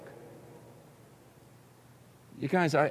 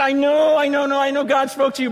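high, loud, hurried insistence; insistent interjection; 8 semitones higher, 5 dB louder than usual. Retold or imitated. Second prophet brushing aside the man of God, high insistence. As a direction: cut in high and pushy, mimicking the prophet.

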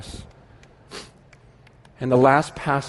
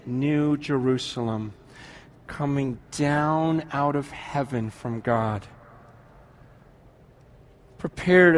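scripture reading; 3 semitones lower than usual. Acted. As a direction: read each phrase gently, letting it settle. Reads scripture slowly, softly.

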